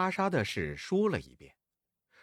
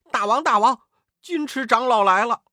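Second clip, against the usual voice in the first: high, loud underling's announcement; urgent little demon; 7 semitones higher, 8 dB louder, faster than usual. A narrator's reading. Little demon reports the elder's arrival, urgent shout. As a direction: call the news out to your master.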